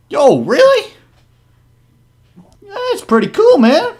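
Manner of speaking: very high, loud goblin voice; excited goblin. Acted. Goblin meeting a fellow guitar player, high excited voice. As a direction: pitch it way up, an impressed goblin.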